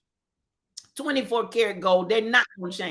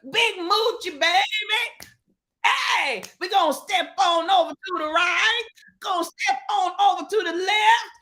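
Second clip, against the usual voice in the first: hype announcer; 7 semitones higher than usual. Acted. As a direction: work the crowd like a gospel MC. Hype shout-out to a streamer.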